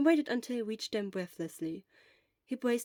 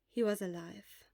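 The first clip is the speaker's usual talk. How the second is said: plain reading voice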